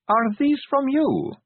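high, slow, pointed question; demanding. A narrator's reading. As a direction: press him for an answer. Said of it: "Sally demanded".

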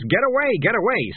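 sudden high shouted blurt; outburst. Acted. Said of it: "See, I did it again".

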